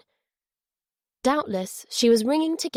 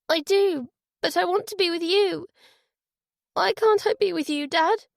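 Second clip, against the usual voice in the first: put-on high, pleading little girl; child pleading, close to tears; holding back tears; 10 semitones higher than usual. A narrator's reading. Daughter begs to be with her father, holding back tears. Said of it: "a tremor of tears being held back".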